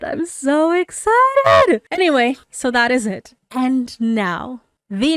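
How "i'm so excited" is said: higher and louder, slow and drawn out; can't contain it, squealing; squealing excitement. Real, not acted. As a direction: let the joy burst out.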